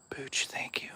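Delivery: breathless, teary whisper of thanks; a soft, tearful thank-you. Real, not acted. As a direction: whisper it as if choked up with emotion. Overcome by a donation heart.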